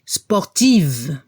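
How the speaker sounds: sportive with a voiced final v; sportive, end on a buzzing v; final V heard. Shown as a demonstration. Teacher repeating the feminine for the class. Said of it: "y al femenino la V".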